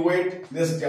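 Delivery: ওয়েডনেসডে with the first D pronounced; wrong, D sounded. Shown as a demonstration. Teacher shows students a common mistake, ওয়েডনেসডে with audible first D. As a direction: voice the D before N in ওয়েডনেসডে. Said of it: "wrong.".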